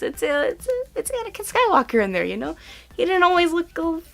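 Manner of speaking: hammed-up voice talking herself out of it; mock sheepish voice. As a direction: play a silly self-justifying fangirl. Mock justification to "guys.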